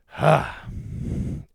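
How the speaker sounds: a long, loud exasperated sigh out of frustration; fed-up loud huff of breath; heavy sigh. Real, not acted. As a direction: blow it all out in a sharp sigh. Angry host exhales after rant.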